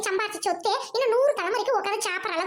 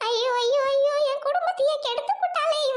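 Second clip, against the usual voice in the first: wailing lament; 6 semitones higher than usual. Acted. Mother-in-law wailing that her family is ruined.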